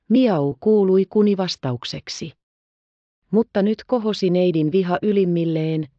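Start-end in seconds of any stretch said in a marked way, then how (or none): 0.1-0.6 meow. Acted. Kitten mews at table.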